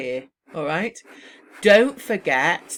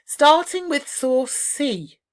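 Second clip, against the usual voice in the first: slow and louder, clearly signposted; model phrases; 4 semitones higher, 5 dB louder, slower than usual. Shown as a demonstration. Teacher demonstrating exam signposting phrases, slow and clear. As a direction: announce each source slowly like a presenter. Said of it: "so you'd say".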